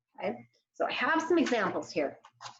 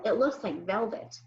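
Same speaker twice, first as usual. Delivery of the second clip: ordinary delivery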